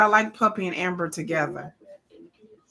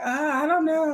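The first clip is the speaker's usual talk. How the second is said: higher-pitched clueless mock voice; dense Puppy; 8 semitones higher than usual. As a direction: lightly act out someone dim and unsure. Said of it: "she's just kinda dense".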